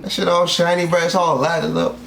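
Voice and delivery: ordinary talk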